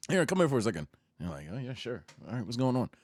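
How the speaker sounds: low, offhand murmur; subtle, casual. Retold or imitated. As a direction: play it cool and understated. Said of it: "just so subtle with it".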